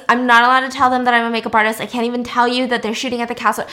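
rushed mock panic. Makeup artist pretends panic to hook the teenager. Fake panic to lure girl.

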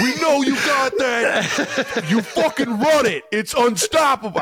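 high, drawn-out heckle in a bad Philly accent; terrible Philly accent. Imitating a Philly fan booing the coach, terrible Philly accent. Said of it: "my terrible Philly accent".

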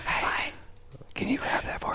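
hoarse whisper to his wife; voiceless, strained whisper. Retold or imitated. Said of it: "I literally whispered all night".

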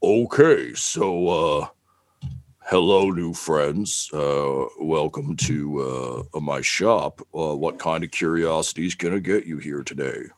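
low, slow big-man voice; grown shopkeeper. Acted. Shopkeeper now bigger, greeting customers low and slow. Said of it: "he's like".

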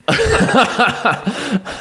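high breathy burst of laughter. Real, not acted. Laughing at OnlyFans joke.

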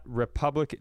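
ordinary talk, nothing marked

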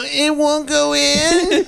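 high, slow, laughing panic; panicked dying soldier. Acted. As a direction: whine it while holding your guts. Soldier stuffing guts back in.

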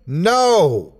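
high, drawn-out cry of refusal; character's scream. A narrator's reading. Mister Everett refusing the creature, screamed. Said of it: "Mister Everett screamed".

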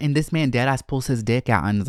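ordinary talk, nothing marked

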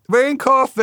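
high, loud party whoop; holiday cheer. Retold or imitated. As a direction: yell it like arriving on holiday. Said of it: "They just were like".

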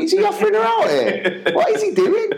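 high, laughing outrage; half-laughing what is going on; laughing disbelief. Retold or imitated. Dad recalling his shock at Santa's offer.

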